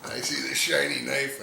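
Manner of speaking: normal talking voice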